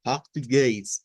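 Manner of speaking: "portugués" slowly, throaty r, gliding -eis ending; Brazilian Portuguese pronunciation. Shown as a demonstration. Teacher insisting it is not Spanish, Portuguese pronunciation. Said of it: "pero eso no es español".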